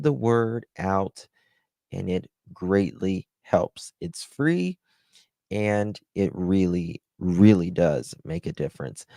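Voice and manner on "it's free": usual speaking voice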